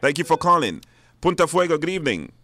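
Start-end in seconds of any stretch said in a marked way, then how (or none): none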